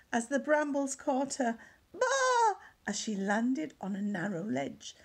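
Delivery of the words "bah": high, loud sheep bleat on landing; startled bleat. Acted. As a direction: bleat as you thump down hard. Sheep lands on a ledge.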